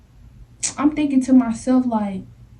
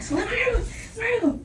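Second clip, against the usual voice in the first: high softer begging to let go; high plea; 10 semitones higher, 7 dB quieter than usual. Retold or imitated. To the girl yanking her hair, high begging. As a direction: whine it high and pleading. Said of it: "I'm like".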